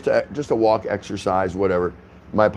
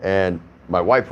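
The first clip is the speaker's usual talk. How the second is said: usual speaking voice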